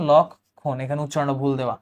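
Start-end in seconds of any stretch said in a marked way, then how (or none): 0.1-0.8 লক্ষণ broken slowly as "lok, khon"; corrected pronunciation. Shown as a demonstration. Teacher repeating the correction, লক্ষণ split in two. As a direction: pause after the first syllable of লক্ষণ. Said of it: "এটা হবে লক্ষণ".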